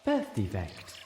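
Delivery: soft, grave answer; solemnly. A narrator's reading. Amaja answers the filly's question softly and solemnly. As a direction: say it quietly and seriously. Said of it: "Amaja told me solemnly".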